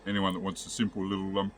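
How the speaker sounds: talking with a mouth full of bread; chewing while talking. Real, not acted. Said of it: "I'm talking with my mouth full".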